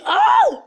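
very high, loud shriek; sudden high-pitched scream; panicked scream. Real, not acted. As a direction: scream as the game goes wrong. Screams as game goes wrong.

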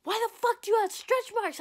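loud, high-pitched retold demand; imitated accusing outburst; dad's outraged yell. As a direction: shout it like a shocked father. Dad confronting her.

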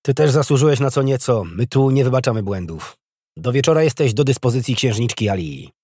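loud, commanding reprimand; stern order. A narrator's reading. Officer assigning a soldier to the princess, loud and stern. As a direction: hand down the punishment firmly.

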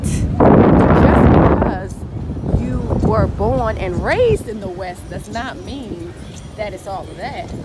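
ordinary talk

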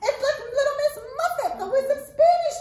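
high, loud excited puppet voice, partly sung. Acted. Puppet Arturo greeting the children, high excited voice. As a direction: bounce in as an eager little monkey puppet.

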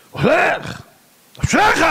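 high-pitched shouted retort from the stern rabbi; shouted exclamation. Acted. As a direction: shout it back high at the driver. Stern rabbi shouts back at driver. (קריאה רמה בצעקה)

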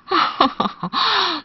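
high falling storybook chuckle; acted happy laugh, pitched high; mother duck's pleased titter; delighted chuckle. Mother duck delighted at swimming duckling.

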